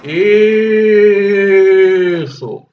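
long loud triumphant yes; drawn-out cheer. Real, not acted. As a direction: stretch it out in triumph. Cheering a success.

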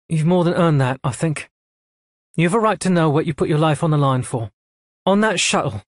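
brisk officer delivering orders; a hint of a cool female commander; lightly voiced admiral. A narrator's reading. Admiral explains.

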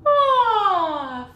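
high, loud open sighing yawn. Shown as a demonstration. Voice teacher demonstrating a yawn sigh. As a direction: let a big yawn sigh out. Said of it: "a little bit of a yawn sigh".

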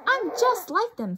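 high, loud delighted squeak; excited bat. Acted. Stella Luna flying at last, high and excited. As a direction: burst with joy at flying. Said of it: "thought Stella Luna".

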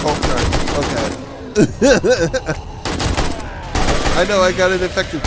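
loud, high shrieking panic; shrieking jackpot winner. Real, not acted. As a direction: shriek it out breathless and wild. Frantic moment in game.

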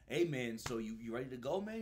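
loud, hearty gunny calling out; a boisterous sergeant hyping up the recruit. Retold or imitated. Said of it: "the gunny goes".

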